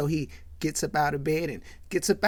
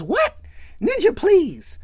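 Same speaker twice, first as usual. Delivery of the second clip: high-pitched acted disbelief, getting louder; incredulous; 12 semitones higher, 5 dB louder, slower than usual. Friend rejecting advice to love himself, high incredulous retort. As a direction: shoot up high and dismiss it.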